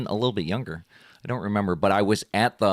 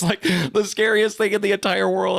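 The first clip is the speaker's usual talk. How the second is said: ordinary delivery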